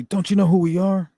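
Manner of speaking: a hint of a bragging biker gang member; tough biker quote. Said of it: "and they say".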